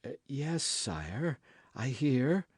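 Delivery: a hint of a stammering, cowed queen; stammered. A narrator's reading. Queen answering the king in fear, mildly stammering. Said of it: "stammered the queen".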